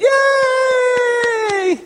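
very high, long-held squeal of a cheer; imitated crowd cheer. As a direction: mimic a hall of cheering nuns. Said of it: "And all the nuns went".

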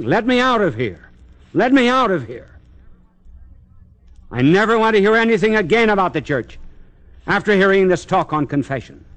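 imitating a screaming woman, high-pitched and urgent; retold shrieking. Priest retelling a young woman in crisis after instruction, imitated high shriek. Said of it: "She was screaming, shrieking".

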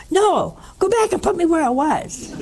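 high-pitched indignant demand; retold mad protest. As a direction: imitate a cross patient insisting. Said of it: "he was so mad".

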